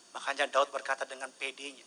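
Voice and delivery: normal talking voice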